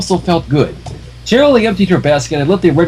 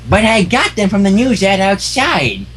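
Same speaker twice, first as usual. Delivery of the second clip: put-on old mare arguing; 3 semitones higher than usual.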